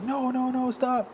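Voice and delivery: high-pitched mock begging; imitated panicked plea. Cornered guy begging, retold.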